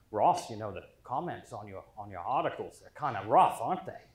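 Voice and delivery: put-on a light Maine accent for the uncle. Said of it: "I can do a perfect Maine accent".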